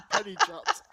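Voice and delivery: very high, loud laughing fit; helpless laughter. Real, not acted. Teasing a friend who finally got it, laughing hard. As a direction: crack up completely, words squeezed through the laugh.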